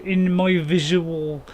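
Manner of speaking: normal talking voice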